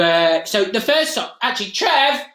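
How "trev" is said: higher-pitched call to someone offstage. Real, not acted. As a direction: call Trev in, pitch lifted. Calling Trev in from off.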